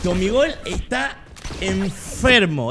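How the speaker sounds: slow build-up, then "enfermo" stretched out; pause, then land heavily on a long "enfermo"; emphatic ENFERMO. Shown as a demonstration. Streamer announcing Tomigol is sick, emphatic drawn-out "enfermo". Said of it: "Digo enfermo".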